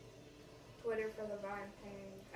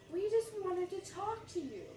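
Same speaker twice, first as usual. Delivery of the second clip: put-on excited teen girl; 9 semitones higher, 5 dB louder, slower than usual. Voicing excited teen girls.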